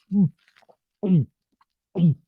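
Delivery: loud throaty gulps, spaced out; imitated gulping. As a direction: imitate noisy gulps with pauses between. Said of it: "ungodly gulping in this scene".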